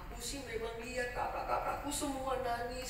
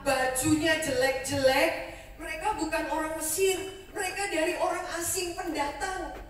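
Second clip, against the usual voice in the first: urgent servant report; 3 semitones higher, 9 dB louder than usual. Acted.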